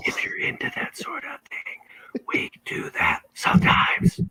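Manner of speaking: breathy ASMR whisper demo. Shown as a demonstration. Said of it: "practice whispering over on our podcast".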